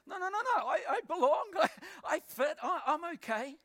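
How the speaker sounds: high, hurried insistence with a laugh in it; frantic protest. Retold or imitated. Man insisting to others that he belongs, frantic protest. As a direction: mock-desperate protest, pitched right up.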